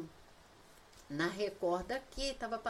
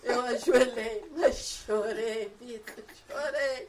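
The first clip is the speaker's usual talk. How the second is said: laughing wail; 2 semitones higher, 8 dB louder, slower than usual. Real, not acted. Woman telling Vitor how she cried and knelt, laughing wail. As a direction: stretch it out, half laughing, half wailing.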